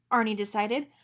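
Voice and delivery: plain reading voice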